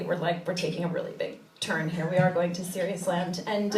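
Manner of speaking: usual speaking voice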